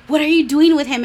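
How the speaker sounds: high, loud mock outrage; mock scandalized. Friends catching her with him in the club, mock scandalized. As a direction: imitate friends demanding an explanation.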